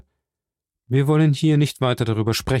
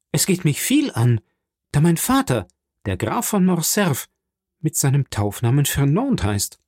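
higher-pitched, offended retort; indignant, pitch raised; agitated young Morcerf; 3 semitones higher than usual. Acted. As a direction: defend your father's name heatedly.

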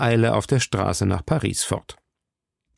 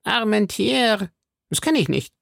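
Porthos, puzzled; 11 semitones higher than usual. A narrator's reading. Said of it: "las Porthos".

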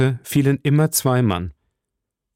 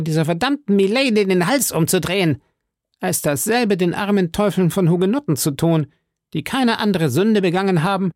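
high, indignant musketeer voice; Porthos; 9 semitones higher than usual. Acted. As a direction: argue it up high, full of bluster. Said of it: "sprach Porthos". (hohe, entrüstete Musketierstimme)

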